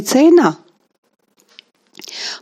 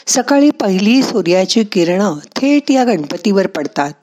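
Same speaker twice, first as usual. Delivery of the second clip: ordinary talk, nothing marked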